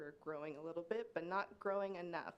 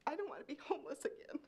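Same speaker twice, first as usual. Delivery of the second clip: fighting back tears as she speaks; getting emotional; 6 semitones higher than usual. Real, not acted. Mother pleading to city commissioners, choking up. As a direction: voice catching, pitched higher, with a teary laugh. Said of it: "Sorry, I'm getting emotional".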